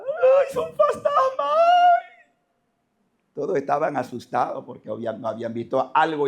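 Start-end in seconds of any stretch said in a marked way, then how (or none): 0.0-1.5 very high, drawn-out mock wail; terrified cry. Preacher acting out apostles seeing a ghost, terrified high cry. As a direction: shriek it like the frightened apostles. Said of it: "todos empezaron a gritar".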